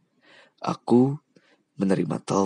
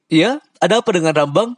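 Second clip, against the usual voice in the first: high, loud, quick question; eager to hear about Rambang; Lubai asking quickly; 8 semitones higher, 5 dB louder, faster than usual. Acted. Lubai quickly asking about Rambang. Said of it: "Lubai bertanya".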